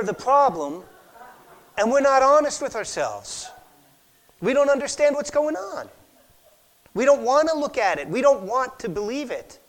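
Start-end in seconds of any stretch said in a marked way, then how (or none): none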